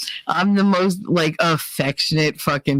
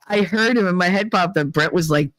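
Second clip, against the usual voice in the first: ordinary talk, nothing marked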